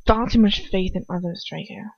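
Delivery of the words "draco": usual speaking voice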